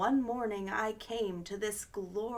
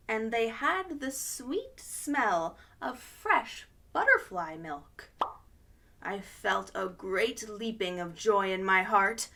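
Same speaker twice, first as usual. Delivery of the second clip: usual speaking voice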